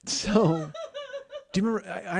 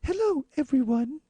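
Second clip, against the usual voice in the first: Kermit voice; 11 semitones higher than usual. Acted. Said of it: "Why am I Kermit the Frog".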